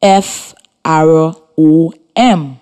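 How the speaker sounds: letters called one by one, spaced out; spelling aloud. Shown as a demonstration. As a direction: spell each letter clearly for dictation. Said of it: "it is spelled".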